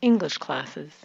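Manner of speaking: "English" stressed, "classes" low with a reduced ending. Shown as a demonstration. Coach contrasts with student's clip, stress on "English". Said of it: "dropping our voice on the second word".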